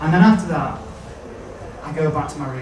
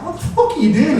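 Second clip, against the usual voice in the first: shocked shout; 8 semitones higher, faster than usual. Retold or imitated. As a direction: shriek it up at him. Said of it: "I'm like".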